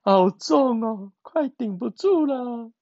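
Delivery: high strained voice under a heavy load; straining little frog. Acted. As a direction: struggle to hold something heavy. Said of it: "另外一只青蛙说".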